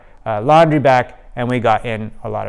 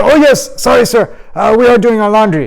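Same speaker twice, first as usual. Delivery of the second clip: put-on nervous boy; 8 semitones higher, 5 dB louder than usual. Boy apologizing to teacher.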